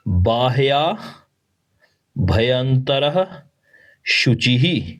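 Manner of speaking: बाह्या भयंतरः शुचिः said slowly with final h sounds; slow syllables. Shown as a demonstration. Teacher spells out the last words. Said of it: "तो यह समरेत".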